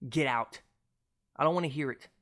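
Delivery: normal talking voice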